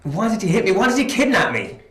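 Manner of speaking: loud, agitated questioning; angry demand. Acted. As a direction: demand answers from your captor, loudly. Captive confronts his kidnapper.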